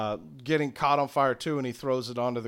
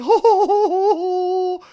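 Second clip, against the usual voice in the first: high, loud gloating laugh; long hooting villainous laugh; villain cackle; 9 dB louder than usual. Acted. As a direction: cackle in triumph. Smithy gloating after his line.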